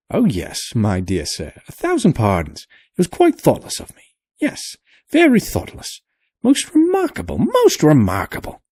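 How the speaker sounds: put-on high, fluttery old man's voice; fussy old professor. A narrator's reading. Professor apologizing to the sailor, fussy and high.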